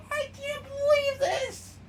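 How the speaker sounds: very high, loud crying shout; exclamation. Acted. Riggy cries out in disbelief as he shrinks, shrill shout. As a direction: shriek it in disbelief. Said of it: "Riggy exclaimed".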